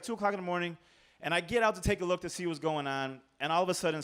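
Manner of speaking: usual speaking voice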